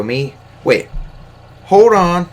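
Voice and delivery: rising to a loud, high hold on; retold shocked comeback; mock indignant surprise. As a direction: act startled then cut her off. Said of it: "And I go".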